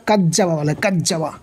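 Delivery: কাজ্জাবা with a hard j as in jam; mistaken j sound. Shown as a demonstration. Teacher mimicking how many misread it, hard j. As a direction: say কাজ্জাবা with a doubled j stop. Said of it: "কাজ্জাবা হবে না".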